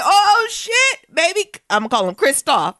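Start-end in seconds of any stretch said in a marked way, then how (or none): 0.0-1.5 amazed. Real, not acted. Hearing the boy speak Russian, a high, stunned exclaim. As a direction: blurt it out in delighted shock.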